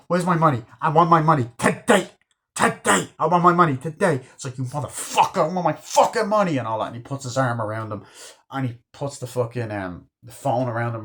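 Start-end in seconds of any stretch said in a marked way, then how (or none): 0.1-4.2 high, loud, insistent demand; De Niro. Retold or imitated. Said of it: "And De Niro's like". 4.6-6.6 mock angry outburst, rising high; De Niro. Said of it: "And De Niro's like".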